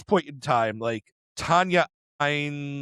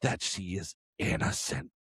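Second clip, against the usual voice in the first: softer, hushed disbelief; retold gossipy murmur; 3 semitones lower, 7 dB quieter than usual. Host voicing Chaltier gossiping about Albedo, hushed mock disbelief. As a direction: share it like scandalous gossip, in a lowered volume. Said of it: "And it's like".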